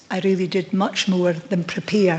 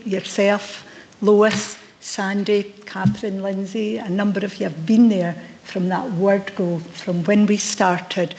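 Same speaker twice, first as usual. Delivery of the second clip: ordinary talk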